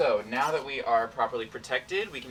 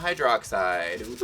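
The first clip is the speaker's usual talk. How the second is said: normal talking voice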